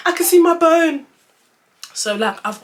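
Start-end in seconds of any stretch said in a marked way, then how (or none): 0.1-1.1 high, loud reenacted shock; horrified cry. Storyteller reliving seeing her own bone, high horrified cry. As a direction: cry it out in acted horror. Louder acted take, retold horror.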